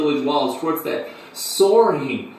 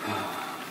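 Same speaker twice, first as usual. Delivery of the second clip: low, very soft sigh; 6 semitones lower, 12 dB quieter than usual. Acted. Storyteller leaving Buffo's family history behind, soft sigh. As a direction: sigh quietly before moving on. Storyteller pauses before resuming the tale.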